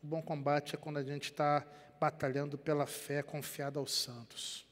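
ordinary delivery

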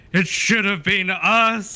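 slow, high, loud mock wail; hammed-up drawn-out lament; mock fan outcry. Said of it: "just being like".

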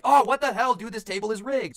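high, loud, angry yell at the rigged table; mock furious outburst. Gambler losing it at the roulette table, loud mock yell. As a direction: rant like a sore loser.

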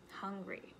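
ordinary delivery

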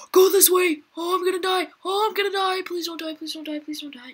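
high, desperate begging; panicked pleading. Real, not acted. Gamer pleading while being chased. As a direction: beg in panic, fading. About to be eaten.

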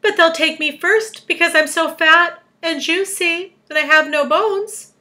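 high, loud mock wail. A narrator's reading. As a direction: lament it loudly in storybook self-pity. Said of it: "wailed the earthworm".

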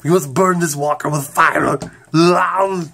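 loud Arnold Schwarzenegger-style vow; Arnold impression. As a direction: boom it out like an Austrian action hero. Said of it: "I'm talking like Arnold".

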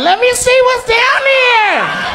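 high, slow, loud mocking squeal; mock woman peering. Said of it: "She like".

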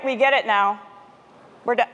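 normal talking voice

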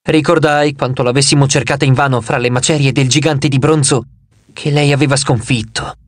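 ordinary delivery, nothing marked

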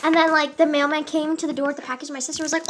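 ordinary talk

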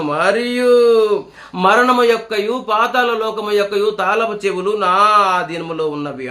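very slow, drawn-out proclamation; solemn scripture recital. Acted. Preacher proclaiming Christ's words to the congregation, very slow. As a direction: let each phrase ring out slowly.

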